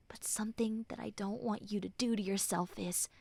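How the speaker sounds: breathy whisper of reassurance. Acted. Friend whispering comfort to a hurting listener. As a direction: whisper it close, like a secret.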